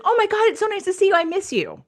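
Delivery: high, fast, gushing hello; gushing friend greeting. Retold or imitated. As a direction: gush like an old friend overjoyed to see you. Friend greeting excitedly on arrival.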